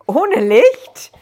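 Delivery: loud, high-pitched startled question; far too high. A narrator's reading. Joan to the intruder after screaming, far too high. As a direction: squeak it out loudly, still shocked from the scare. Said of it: "in immer noch viel zu hohem Ton".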